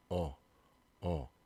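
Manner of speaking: "어" as a rounded aw; "어" held as an open aw sound; between O and EO. Shown as a demonstration. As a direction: say "어" halfway between oh and uh, mouth barely moving. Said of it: "어 발음이 약간 중간이에요".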